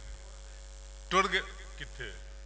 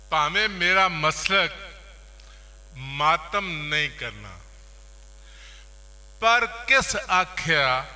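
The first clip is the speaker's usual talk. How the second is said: raised, projected mushaira recital, lines repeated with pauses; poetry recitation; 4 semitones higher, 7 dB louder than usual. Acted. Poet reciting Karbala verses to listeners, declaimed. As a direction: declaim each half-line, pausing before the next.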